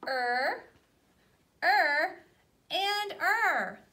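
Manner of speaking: "er" said loudly three times, the same each time; loud, clear phonics demo; er sound demo. Shown as a demonstration. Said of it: "They wanna be the same".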